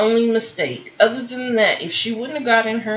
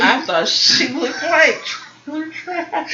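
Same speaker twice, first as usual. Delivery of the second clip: laughing; 7 semitones higher, slower than usual. Real, not acted. Laughing put-down of look.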